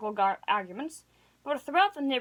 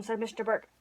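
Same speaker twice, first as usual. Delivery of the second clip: ordinary delivery, nothing marked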